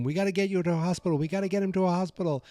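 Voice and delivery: high, rushed urgent plea; mock urgency. Kids to the wounded agent, urgent and high-pitched. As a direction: imitate someone urging a hospital, fast.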